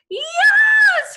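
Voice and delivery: high, loud shriek; piercing scream. A narrator's reading. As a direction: Leslie shrieks as her pigtail is pulled. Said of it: "screamed Leslie".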